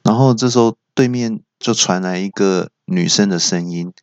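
ordinary delivery

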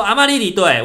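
loud, high shout; calling out again. Retold or imitated. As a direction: call her name out across the slope. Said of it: "我就再喊一次".

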